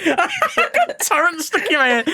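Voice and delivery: high, slow, giggled words; laughing. Real, not acted. Giggling at a word stuck in the head, laughing. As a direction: talk through a fit of giggles.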